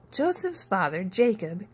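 plain reading voice

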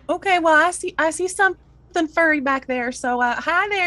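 high, sweet character voice; reassuring, high-voiced lady; coaxing Ursula.

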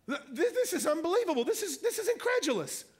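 high, stammering, laughing amazement; amazed disbelief. Real, not acted. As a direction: stumble over the words in delighted disbelief. Preacher marvels at the passage.

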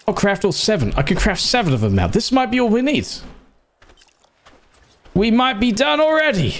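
high, excited realisation. Real, not acted. Gamer thinks the ladder job is done, excited. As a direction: pitch soaring with sudden hope.

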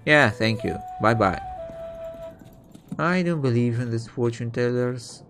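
ordinary talk

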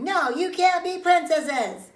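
loud, high, slow insistence; bossy protest. Acted. Child bars playmates from being princesses, loudly bossy. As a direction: shout them down word by word. Child not playing nicely with friend.

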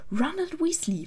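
high-pitched stern call by full name. A narrator's reading. Hermine pulls Ron up for teasing Harry, stern. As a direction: scold him sternly by his full name. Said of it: "in einem strengen Ton".

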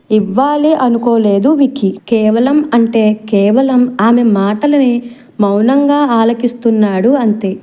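usual speaking voice

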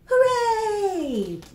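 high, loud cheer; excited cheer. Acted. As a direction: cheer it out like a happy bird. Reading bird book to kids.

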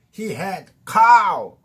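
big, loud, slow. Shown as a demonstration. Teacher telling learners to speak up, loudly. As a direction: boom it out slowly. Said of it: "이렇게 크게 말해".

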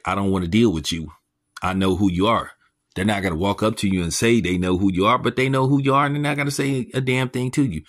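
ordinary talk

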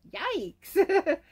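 high, loud comic yelp; mock alarm. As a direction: play the fright big and comic. Acted alarm in read story.

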